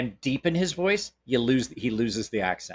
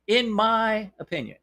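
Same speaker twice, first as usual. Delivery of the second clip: pitched-up, teasing repeat of the disclaimer; mock emphatic hedge; 8 semitones higher than usual. Shown as a demonstration. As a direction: say it again like a wink to the lawyers. Repeats hedge, higher mock take.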